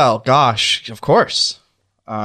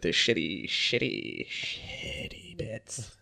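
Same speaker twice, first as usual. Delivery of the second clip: fading to a whisper; 4 semitones lower, 14 dB quieter, much slower than usual. Acted. [name] griping about Danny's bits, fading to a whisper. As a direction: sink slowly into a breathy whisper.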